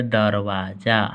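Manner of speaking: दरवाज़ा stretched out slowly; slow model word. Shown as a demonstration. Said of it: "जो घर में होता है". (दरवाज़ा को धीरे-धीरे बोलते हुए)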